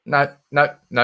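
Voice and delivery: ordinary talk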